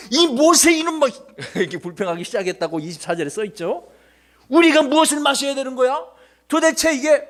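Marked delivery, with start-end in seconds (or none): 0.1-1.1 high, loud mock scolding; acted anger. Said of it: "더 화가 나가지고". 4.5-7.2 indignant, high-pitched protest; acted angry demand. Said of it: "화가 났던 거예요".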